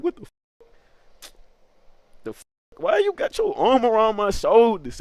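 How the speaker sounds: high-pitched disbelieving question; incredulous, voice jumping up high; retold shocked reaction. Retold shocked reaction to stranger's arm.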